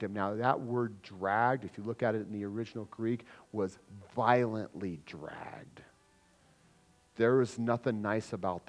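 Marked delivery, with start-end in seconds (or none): 3.5-5.8 slow hushed whisper. Acted. Preacher explaining the Greek, in a slow whisper. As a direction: breathe the words out for weight. Stressing violence of the word.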